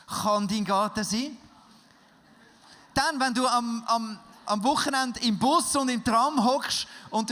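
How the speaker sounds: laut und hoch, eindringlich predigen; Predigt mit voller Kraft. Acted. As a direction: mit Feuer zur Gemeinde predigen. Preaches to the congregation. (laut predigend, der Prediger ruft die Gemeinde auf)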